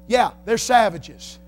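sarcastic pulpit delivery; mild preaching. Acted. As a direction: lean into it like a preacher. Preaching, louder than his chat.

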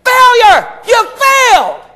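high, loud accusing yell; condemning shout. Acted. Preacher acting out holding dad's mistakes over him, condemning shout. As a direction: hurl it out as a verdict.

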